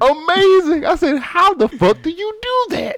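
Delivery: high amazed disbelief. Retold or imitated. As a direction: marvel at it, way up high. Amazed retelling of girl's trick.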